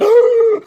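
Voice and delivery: loud, high hammed-up gasp; mock a huge shocked intake of air. Man re-enacts seeing the time, exaggerated gasp. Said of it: "I just gasped for air".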